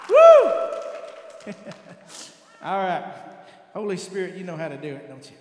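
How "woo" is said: loud, high whoop; excited whoop. Real, not acted. Pastor cheering at the height of the sermon, loud whoop. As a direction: let out a joyful yell. Preaching peak exultation.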